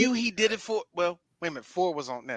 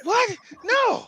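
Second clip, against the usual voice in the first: very high-pitched celebrity impression; mock actor denial; 13 semitones higher than usual. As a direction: deny it in a put-on high voice. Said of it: "Mark, you're out of breath".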